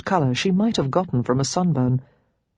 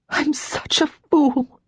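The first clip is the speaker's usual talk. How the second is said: high, slow, gasping self-reproach; breathless, broken cry; gasped; 10 semitones higher, slower than usual. Acted. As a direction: gasp it out in shame. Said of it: "she gasped".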